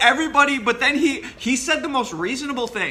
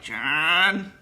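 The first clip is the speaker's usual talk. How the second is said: nagging mom; 4 dB louder, much slower than usual. Retold or imitated. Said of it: "like the nagging mom".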